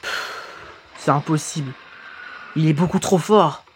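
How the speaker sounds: lightly acted low, quiet dialogue; game character, discouraged. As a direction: voice a defeated fighter, low and subdued. (voix de personnage découragé, basse et retenue)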